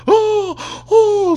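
high-pitched drawn-out fake "ohh"; hammed-up falsetto howls of agony; mock pain wail. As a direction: wail like a soccer player faking an injury. Mimics flopping soccer players' cries.

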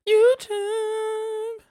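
YouTube squealed very high; high squealed shout. Acted. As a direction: squeal it out like a hype call. Shouting the channel name.

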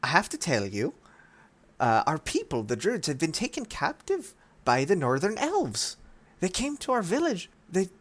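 high pleading druid tale; Denier's voice. Acted. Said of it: "Denier says".

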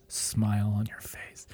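breathy sultry whisper; sexy whisper. Pretend. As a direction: whisper it low and seductive. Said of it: "How do you do a real sexy syrup voice".

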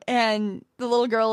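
ordinary delivery

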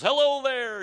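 high, loud, jolly-sounding hail; imitated booming greeting; Mister McBeevy. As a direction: call out a big hello as a storybook stranger. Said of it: "kind of this Irish accent".

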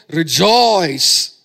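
loud, drawn-out preacher's cry; proclaiming shout. Acted. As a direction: cry it out to all mankind. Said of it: "It screams to mankind".

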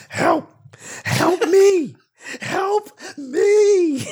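high, loud, drawn-out mock wail; screaming for help. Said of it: "That's way different. His was".

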